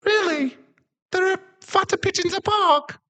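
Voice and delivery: very high, eager puppy voice; excited dog boy. Acted. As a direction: chirp it sweetly like a puppy. Dog boy begs about pigeons.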